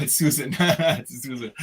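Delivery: saying the name through real laughter; laughing. Real, not acted. Guest laughing while reading out a fan's name, laughing. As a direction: chuckle the name out, caught off guard.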